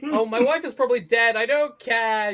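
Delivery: loud, high, hammed-up outburst; mock ranting. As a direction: rant dismissively, dragging the words out. Acts out Daniel not caring.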